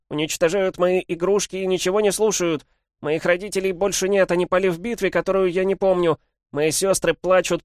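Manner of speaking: put-on high, loud pleading of a lonely child; lightly voiced small boy crying out for peace; little boy's voice. A narrator's reading. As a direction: beg the lords like the last child left. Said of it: "голосом маленького мальчика".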